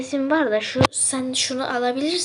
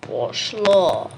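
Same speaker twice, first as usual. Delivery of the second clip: loud command; 6 dB louder than usual. Acted.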